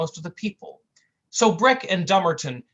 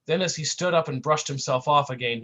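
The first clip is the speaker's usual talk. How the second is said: ordinary delivery, nothing marked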